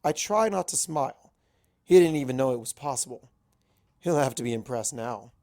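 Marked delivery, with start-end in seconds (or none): none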